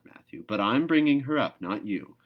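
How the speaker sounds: a sharp stress on bringing; firm insistence. A narrator's reading. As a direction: put Matthew in his place. Marilla insisting to Matthew.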